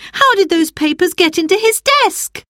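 high, loud indignant cry. Acted. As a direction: cry it out defending Father. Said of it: "cried Roberta".

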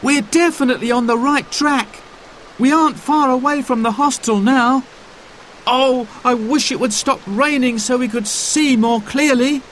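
put-on young boy hiking in the rain; boy's voice. A narrator's reading. Child voice in story.

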